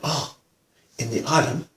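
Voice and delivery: soft, hesitant explanation; quietly spoken. Retold or imitated. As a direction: imitate a soft-spoken policeman. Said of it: "he was very quietly spoken".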